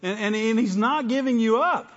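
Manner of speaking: loud, high fervent preaching; rising, fiery sermon voice; preaching at full force. Acted. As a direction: lift it up and preach it out. Preaching climax.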